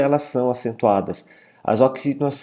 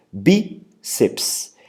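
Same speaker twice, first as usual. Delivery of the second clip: BÍ-ceps, com o bí acentuado; sílaba tônica. Shown as a demonstration. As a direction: marque a tônica em bí. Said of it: "a tonicidade recai na penúltima sílaba". (bíceps com força no bí)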